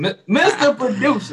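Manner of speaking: high, loud comic outrage; mock outrage. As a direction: call out the producer indignantly. Calling out to the producer.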